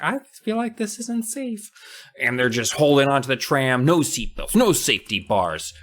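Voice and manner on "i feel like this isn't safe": scared high voice. Acted. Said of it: "so she's just like".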